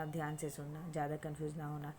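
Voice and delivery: ordinary delivery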